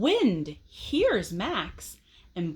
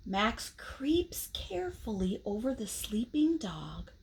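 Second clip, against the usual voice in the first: whisper; 7 dB quieter than usual. A narrator's reading. Narrating sneaking past dog.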